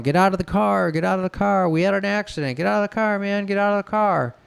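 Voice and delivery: high-pitched, frantic imitated yell; urgent high yelling, not full volume; retold yelling. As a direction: imitate carjackers yelling over and over. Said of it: "All three guys are yelling".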